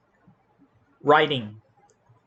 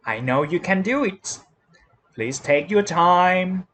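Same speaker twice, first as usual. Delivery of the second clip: ordinary delivery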